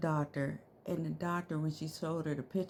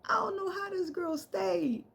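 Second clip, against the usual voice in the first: laughing disbelief; 13 semitones higher than usual. Real, not acted.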